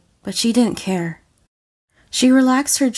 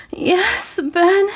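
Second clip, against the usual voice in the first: breathless cry; 11 semitones higher than usual. Acted. Ray answering Ben in bed, high breathless cry. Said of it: "she hissed".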